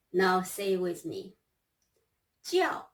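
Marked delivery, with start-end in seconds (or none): 2.4-2.9 叫 held high and level; first tone. Shown as a demonstration. Teacher models 叫 high and level for students. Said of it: "first tone".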